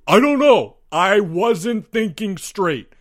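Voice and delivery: high, loud, flustered protest; desperate outburst. A narrator's reading. Albert answers Greg's challenge, bursting out desperately. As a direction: burst out, then slow down while still high.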